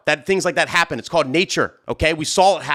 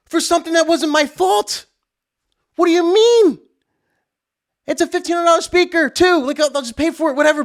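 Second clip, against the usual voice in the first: high-pitched indignant protest; mad, incredulous; 11 semitones higher than usual. Retold or imitated. DJ asked about insurance by the coordinator, indignant outrage. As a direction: argue in disbelief, voice way up. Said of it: "getting even more mad".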